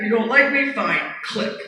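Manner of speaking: ordinary talk, nothing marked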